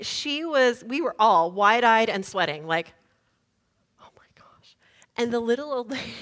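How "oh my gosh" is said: barely audible, shocked whisper; stunned whisper. Retold or imitated. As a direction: whisper it wide-eyed after a near crash. Everyone stunned after near crash.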